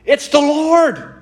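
high, slow exclamation; high, slow exclamation of wonder; excited cry. A narrator's reading. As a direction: cry out in awe. Disciple recognizes Jesus.

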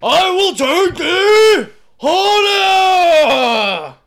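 huge, very slow roaring Viking battle cry; Viking accent. Pretend. Asked for a Viking accent, he roars like a Viking chief. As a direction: bellow it from the prow. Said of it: "like a Viking accent".

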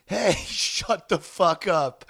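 high, drawn-out mock yell; retold yell. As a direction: imitate yelling at a car alarm, without full volume. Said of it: "I just turn and yell".

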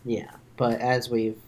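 ordinary talk, nothing marked

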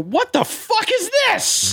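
very high, drawn-out mock-outraged squeal; slow, incredulous high-pitched protest; retold outrage. Retold outraged reaction to voiceover.

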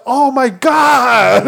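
high, slow awed voice; awed little kids. Retold or imitated. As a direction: stretch it out like wide-eyed kids.

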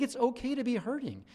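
usual speaking voice

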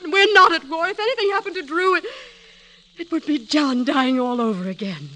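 a high, rising plea that keeps breaking into upset; distressed pleading. Acted. Mother to husband, begging him to keep her son home, distressed plea. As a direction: she fights against losing another son.

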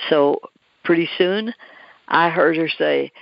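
usual speaking voice

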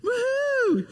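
high whooping cheer; gleeful coaster whoop; excited woohoo. Shown as a demonstration. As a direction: shout it like a thrilled rider. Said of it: "You're thinking this".

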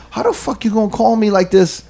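high-pitched retold protest; aggrieved complaint. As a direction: complain to a friend who set you up. Retold complaint to friend on phone.

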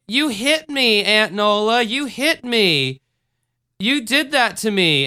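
mock-wounded child blaming his aunt; kid's fake accusation. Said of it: "I just thought it would be funny to say".

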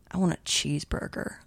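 quiet, mildly pitiful retold voice; weak sick friend. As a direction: imitate a feeble patient's request. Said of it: "she goes".

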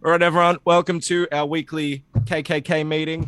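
joking impression of a comics writer hosting a meeting; Jeff Johns impression. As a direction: open the meeting like a mock cult leader. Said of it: "I can do a great Jeff Johns impression".

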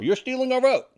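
accusing. Real, not acted. Accusing the illegal voters.

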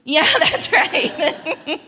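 laughing through slow words. Real, not acted. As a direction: chuckle at yourself, drawn out. Laughs at own feistiness.

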